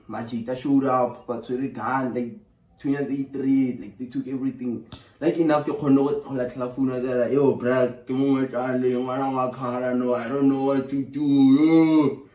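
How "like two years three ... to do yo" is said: usual speaking voice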